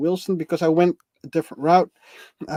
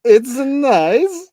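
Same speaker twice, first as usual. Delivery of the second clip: high, slow, loud Borat drawl; Borat accent; 7 semitones higher, 7 dB louder, much slower than usual. Retold or imitated. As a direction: stretch it out like Borat. Echoing Borat catchphrase.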